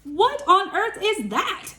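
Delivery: high, slow mock astonishment; hammed-up witch surprise; startled witch. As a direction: gape at the mess.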